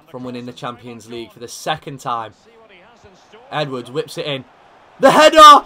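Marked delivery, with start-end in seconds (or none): 5.0-5.7 loud high cry at the header; alarmed shout. Real, not acted. Manager watching a late header, shouting. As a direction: yell as the ball is headed.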